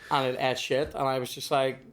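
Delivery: ordinary delivery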